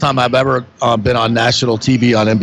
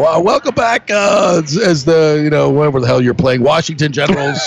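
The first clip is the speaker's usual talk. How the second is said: hammed-up broadcaster; 2 semitones higher than usual. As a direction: ham up a frantic live broadcast welcome. Retelling his on-air sideline intro.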